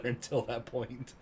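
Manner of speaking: ordinary talk, nothing marked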